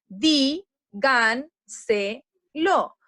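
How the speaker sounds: "Di, gan, se, lo" as four separate beats; dividing into syllables. Shown as a demonstration. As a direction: say "Di, gan, se, lo" with a pause after each syllable. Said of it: "la palabra se divide en sílabas".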